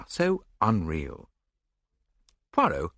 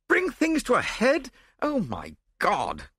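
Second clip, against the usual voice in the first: high, appalled echo, then slow oath; aghast lord's rising question; crying out. A narrator's reading. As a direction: cry out in disbelief. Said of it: "cried Lord Yardley".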